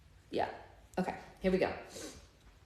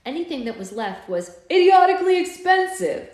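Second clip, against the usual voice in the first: much higher, louder angry outburst; 10 semitones higher, 11 dB louder than usual. Acted. Sam fuming at price-gouging merchants, loud and angry. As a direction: let the anger burst out. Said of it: "Sam said angrily".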